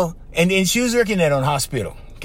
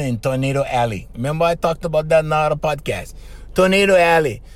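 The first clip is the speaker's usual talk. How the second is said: ordinary talk, nothing marked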